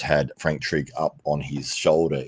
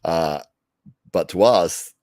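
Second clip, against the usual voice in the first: usual speaking voice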